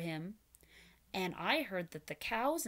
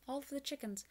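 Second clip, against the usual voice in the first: usual speaking voice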